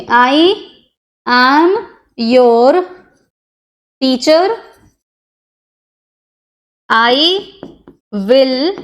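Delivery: one word at a time, long pauses between; word-by-word dictation. Shown as a demonstration. Teacher writing out the sentence for students, word-by-word dictation. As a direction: dictate it word by word, leaving gaps to write. Reading out words while writing them.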